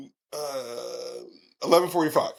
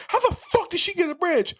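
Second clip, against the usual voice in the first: incredulous; 13 semitones higher than usual. Real, not acted. Incredulous question about movie scene.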